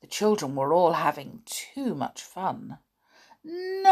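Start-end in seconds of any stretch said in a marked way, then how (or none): 3.4-3.9 high whiny no; whining. A narrator's reading. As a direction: whine it like a tired child. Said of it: "they whined".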